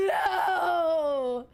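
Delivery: real despair cracking into laughs; laugh-crying. Real, not acted. Streamer wailing to chat over her useless purchase, laugh-crying. Upset over record player.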